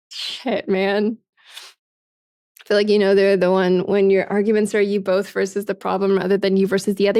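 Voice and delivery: ordinary talk, nothing marked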